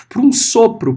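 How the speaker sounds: normal talking voice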